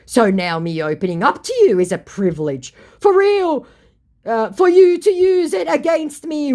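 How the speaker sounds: mock the scammer's wounded pride; hammed-up offended scammer. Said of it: "To which my scammer said".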